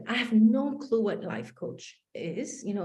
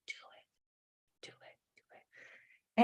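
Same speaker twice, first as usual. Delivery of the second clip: inner voice whisper; 11 dB quieter than usual. Retold or imitated. Inner voice urging her to take the leap, whispered. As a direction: hiss it like a voice inside your head. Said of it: "something inside of me would go like".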